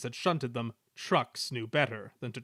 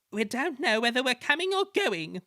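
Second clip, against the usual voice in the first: higher, fussy coaches protesting; 11 semitones higher, 4 dB louder than usual. A narrator's reading. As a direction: whine like overworked coaches. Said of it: "they protested".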